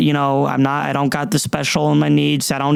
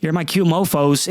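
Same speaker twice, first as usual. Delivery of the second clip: normal talking voice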